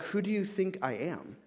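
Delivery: a pointed, sarcastic question. Retold or imitated. God challenging Moses, mildly sarcastic. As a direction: throw it back with a touch of sarcasm. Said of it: "like, sarcastic".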